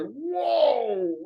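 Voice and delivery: acting out a dawning realisation; stunned by revelation; sudden epiphany. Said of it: "you get an epiphany".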